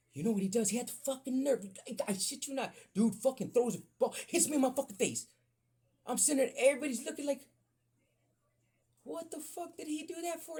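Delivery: high and loud, ranting; worked-up retelling. Retold or imitated. Telling the crowd how he got hit, high heated rant. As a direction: get fired up telling it.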